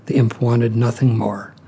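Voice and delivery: plain reading voice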